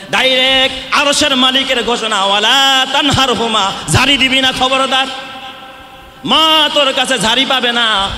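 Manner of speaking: high, loud sermon declaration; preaching. Acted. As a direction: proclaim it to the crowd. Preacher declaring God's command.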